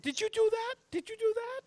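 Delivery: very high falsetto shouting; frantic yelling. Acted. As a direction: yell it urgently, way up high. GM voices Abby yelling.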